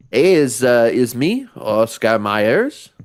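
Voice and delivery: put-on slow, halting Quebecois French accent. Villain's long-lost brother introducing himself, Quebecois accent. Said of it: "why he sounds Quebecois".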